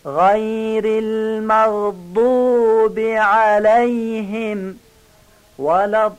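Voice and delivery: slow, high chanted recitation; Quranic recitation. Shown as a demonstration. (تلاوت آهسته و آهنگین)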